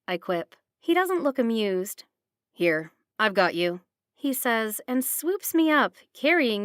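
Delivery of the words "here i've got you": low gruff father voice; a firm low take-charge man. Acted. Logan lifts her up.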